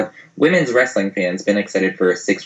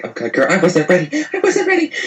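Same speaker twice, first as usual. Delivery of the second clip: imitated fluster climbing to a squeal; mock panic; 9 semitones higher than usual. Co-host imitating Eva as Naomi's music hits, mock panic. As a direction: squeak it like a wrestler caught off guard.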